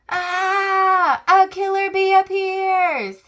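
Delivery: high, loud alarmed cry at the bee; scared shriek. Acted. Squirrel spotting the killer bee, shrieking in fright. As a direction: scream as if the bee is right there.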